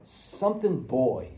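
drawn-out mumbling guess; slow vague guess. Retold or imitated. Malingering patient stalls on the word, slow. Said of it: "or, you know".

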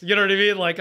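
ordinary delivery

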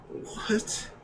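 very high, airy mock disbelief; stunned disbelief. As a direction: squeak out a retold stunned reaction. Retold stunned reaction to coworker's news.

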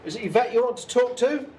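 high, loud question; calling out. Real, not acted. Investigator checks a spirit's answer, calling out high. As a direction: throw the question out to someone unseen.

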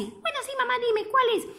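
put-on high eager child voice; eager little turtle offering to help her mother; little tortoise voice. Little turtle answering mama, high and eager.

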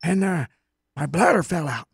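put-on high-pitched old man telling a tale; comic old-timer voice, high; little old man. Shown as a demonstration. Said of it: "little old man".

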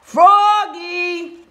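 high, drawn-out name call; long, high-pitched holler; coach's yell. A narrator's reading. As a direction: yell across the field. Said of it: "yelled the coach".

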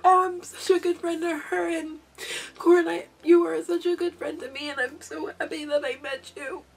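high, tearful, emotional thanks; choked up. Real, not acted. Crafter thanking her friend after reading her letter, choked up. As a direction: hold back tears, voice rising and softening. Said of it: "I got really emotional for a second".

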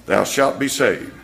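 ordinary delivery

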